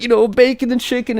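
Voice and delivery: high, whiny kid imitation; put-on little-girl whimper; mock crying. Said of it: "she's crying like that".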